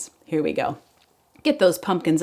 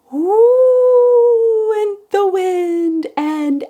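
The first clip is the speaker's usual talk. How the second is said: high, slow wind whoosh; howling wind; 12 semitones higher, 8 dB louder, much slower than usual. Acted. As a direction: howl like the wind. Poem wind sound, voiced wind.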